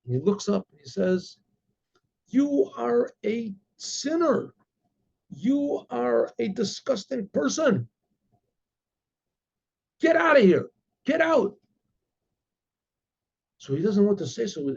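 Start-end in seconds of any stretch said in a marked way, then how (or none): 2.3-7.9 slow, high, weighty condemnation; stern rebuke. Retold or imitated. Holy man rebukes visitor. 10.0-11.6 much higher, louder, commanding him out; angry shout. Retold or imitated. Holy man throws him out.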